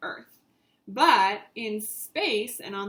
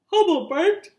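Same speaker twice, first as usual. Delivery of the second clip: louder, higher bark line; 6 semitones higher, 11 dB louder than usual. A narrator's reading. Reading to kids, dog barking loudly. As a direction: sound out the bark.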